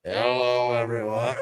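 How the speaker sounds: robotic voice filter. Pretend. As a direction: speak through a robot voice changer. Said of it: "I got a robot. Watch this".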